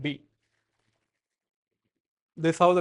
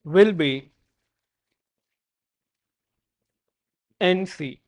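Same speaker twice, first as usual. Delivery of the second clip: dictating. Shown as a demonstration. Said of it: "Next note".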